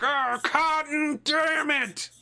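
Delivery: loud, drawn-out high yell; high-pitched howl of gaming frustration; exasperated screaming. Retold or imitated. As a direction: scream it in long, frustrated syllables. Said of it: "I spend the night screaming Cotton's name".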